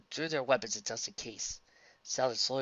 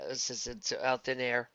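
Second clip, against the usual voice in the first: plain reading voice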